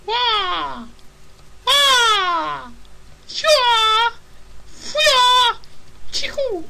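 very high, loud shrieked cries; high battle squeals. Pretend. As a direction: squeal out each attack. Mock battle cries while throwing.

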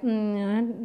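usual speaking voice

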